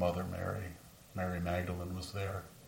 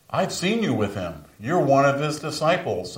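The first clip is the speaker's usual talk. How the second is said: put-on accusing girl; 6 semitones higher, 9 dB louder than usual. Girl accuses Peter of discipleship.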